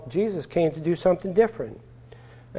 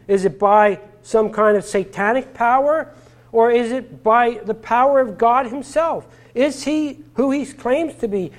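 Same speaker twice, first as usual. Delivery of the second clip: higher, louder preaching with climbing questions; building sermon; 5 semitones higher, 3 dB louder than usual. Acted. Preacher to congregation, building with rising fervent questions. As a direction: drive the questions home to the congregation.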